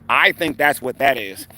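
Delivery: ordinary talk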